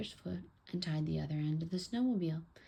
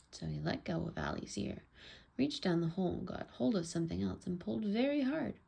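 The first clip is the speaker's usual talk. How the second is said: ordinary delivery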